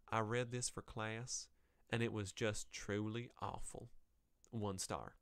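slow, put-on Southern US accent. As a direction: read it slowly with a Southern twang. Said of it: "we'll give her one".

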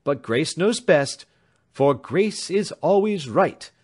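a devoted sister praising Grace; fond younger sister. A narrator's reading.

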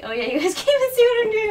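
ordinary talk

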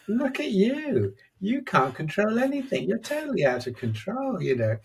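high, mocking critic voice sneering at himself; inner critic. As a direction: taunt like a scornful inner critic, pitched well up. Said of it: "then the critic turns up".

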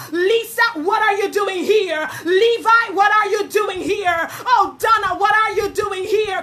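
loud calling out of names; God calling to his people, rising. Acted. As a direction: call each person like God searching. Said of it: "That's what God was asking Elijah".

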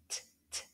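whispered bare t, t; crisp t sound alone. Shown as a demonstration. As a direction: tap out the voiceless t. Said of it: "begins with the sound t".

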